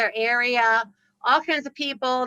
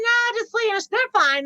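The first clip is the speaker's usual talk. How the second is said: breezy dismissal; 9 semitones higher than usual. Retold or imitated. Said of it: "I'm like".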